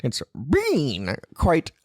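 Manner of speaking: put-on a light Canadian accent; lightly put-on Canadian accent. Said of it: "Jordan Peterson type accent or slash Canadian accent".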